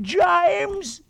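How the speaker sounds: very high, drawn-out wailing calls; mock British-accented whine, calling for the boys; whining girl calling out. Podcaster mocking horror heroine, high whiny wailing. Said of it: "this girl running around going".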